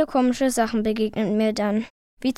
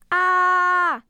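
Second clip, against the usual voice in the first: high sustained shriek; long, loud cry of fright; startled scream; 8 semitones higher, 5 dB louder than usual. A narrator's reading. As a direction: scream as if caught off guard. Startled by sudden voice behind.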